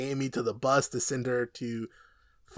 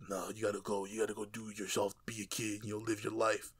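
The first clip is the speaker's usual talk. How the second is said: softer, low, gentle send-off; tender farewell; 5 semitones lower, 11 dB quieter than usual. Retold or imitated. Frank sending Amy off, softly tender. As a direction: quietly tell the kid to go. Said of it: "start to crack a little bit".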